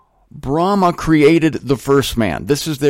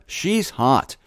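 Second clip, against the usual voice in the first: ordinary talk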